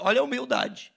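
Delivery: usual speaking voice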